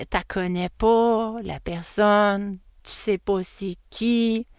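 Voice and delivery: very slow, spelled-out delivery; slowly driving the point home; drawn-out emphasis. Real, not acted. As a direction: draw out each phrase for emphasis.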